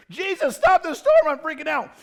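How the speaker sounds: high, loud panicked cry; hammed-up freaking out; mock panic. Panicking believer cries to Jesus in the storm, high and loud.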